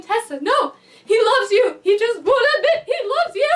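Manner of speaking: hammed-up, high and loud plea; exasperated pleading. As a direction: plead with a fictional character, voice rising. Acts out pleading with a character.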